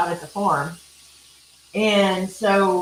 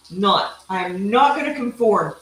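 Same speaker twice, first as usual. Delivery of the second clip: ordinary talk, nothing marked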